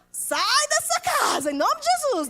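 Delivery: high, swooping mock command; imitated child's rebuke. Taught child facing a battle, mock rebuking. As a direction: cry it out like a zealous child.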